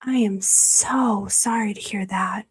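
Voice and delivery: slow, whispered condolence; whispered, slow and sorrowful; hushed sympathy. Real, not acted. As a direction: gently console a grieving viewer. Viewer's brother was killed.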